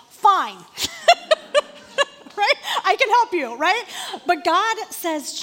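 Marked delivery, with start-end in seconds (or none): 0.1-0.6 a loud, sharp "Fine"; curt fed-up retort. Retold or imitated. An impatient God snapping "Fine" at Jonah. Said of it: "most of us would have been like". 0.6-2.3 laughing. Real, not acted. Preacher laughs at own joke. 2.8-3.5 sarcastic. Retold or imitated. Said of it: "most of us would have been like".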